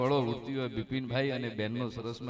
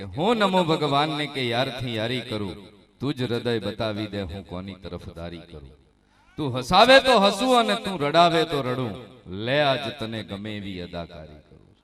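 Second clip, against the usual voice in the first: raised voice for verse; poem recital; 3 semitones lower, 8 dB louder than usual. Acted. Performer to the saint's devotees, raised recital. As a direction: lift the couplet out to the crowd.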